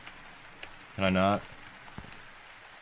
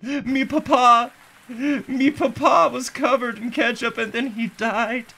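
mock wailing baby; 8 semitones higher than usual. Baby mourning his ketchup-covered papa, hammed-up wail. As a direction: wail like a toddler in mock grief.

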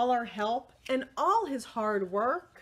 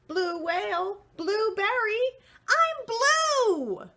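high loud exultant shout; high joyful shout; joyful discovery; 12 semitones higher, 6 dB louder, slower than usual. Acted. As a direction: cry it out in triumph. Crayon discovers his color.

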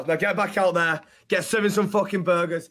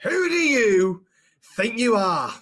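raised, stretched-out scolding; angry reprimand; 2 semitones higher, 4 dB louder, slower than usual. Acted. Boss repeats the dressing down.

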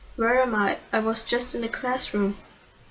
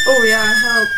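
much louder hammed-up cry for help; mock ghost call; 12 dB louder than usual. Voicing ghost calling for help.

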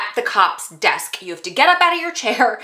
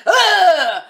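frustrated groan; 10 semitones higher, 5 dB louder than usual. Retold or imitated. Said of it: "and I was like".

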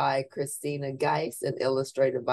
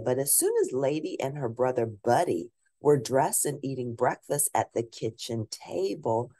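ordinary delivery

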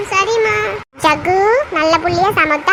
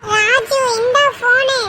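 put-on child friend; 4 semitones higher, much slower than usual. Child Pappu hands over phone.

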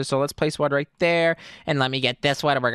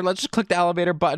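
ordinary delivery

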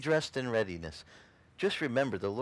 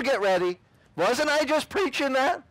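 high, loud I-told-you-so; hammed-up high told-you-so; mock gloating preacher; 13 semitones higher, 6 dB louder than usual. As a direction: crow like he was right all along.

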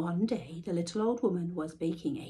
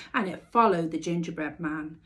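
ordinary delivery, nothing marked

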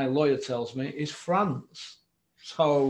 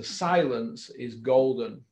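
each word laid down slowly; slow, weighty saying; 3 semitones lower, much slower than usual. Real, not acted. To John, quoting a Doncaster saying, slow and deliberate. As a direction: lay the proverb out slow and heavy.

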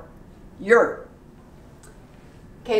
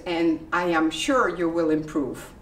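usual speaking voice

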